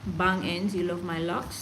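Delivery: ordinary talk, nothing marked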